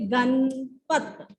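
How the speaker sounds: Ganpat drawn out very slowly; slow dictation. Shown as a demonstration. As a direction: stretch Ganpat for the children. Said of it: "Ganpat कैसे लिखेंगे?". (गणपत धीरे धीरे बोलकर)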